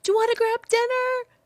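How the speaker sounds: high, loud call up the house; yelling down the stairs. Retold or imitated. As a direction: holler it down the stairs. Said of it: "just yelling down the stairs".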